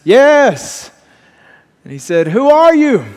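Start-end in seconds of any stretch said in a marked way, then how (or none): 0.0-0.9 high, loud booming answer from the heavens; voice from above. Acted. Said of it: "And a voice says". 2.3-3.1 high, loud shouted question; yelling out. Acted. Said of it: "he, he yells out".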